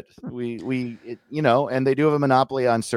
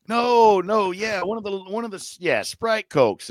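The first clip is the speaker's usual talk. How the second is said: put-on Southern accent; 9 semitones higher than usual. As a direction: fumble the soda names. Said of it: "they were like".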